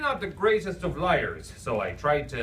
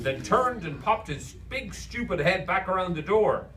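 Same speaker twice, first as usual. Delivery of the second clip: ordinary delivery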